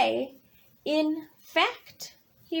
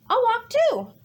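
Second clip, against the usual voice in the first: higher, louder eager rabbit; perky rabbit; 7 semitones higher, 6 dB louder, faster than usual. Acted. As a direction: greet Bird like a bouncy rabbit. Rabbit joins Bird.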